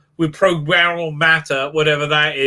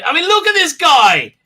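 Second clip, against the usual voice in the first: usual speaking voice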